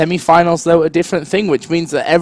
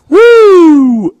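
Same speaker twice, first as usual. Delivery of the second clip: loud high whoop; big excited cheer; 14 semitones higher, 8 dB louder than usual. Real, not acted. Cheering co-caster intro.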